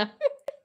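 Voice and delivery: a short high laugh that breaks almost into a cry; squealing laugh. Real, not acted. Cracking up at a comment on her live, high squeal.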